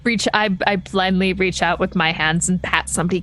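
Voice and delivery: ordinary delivery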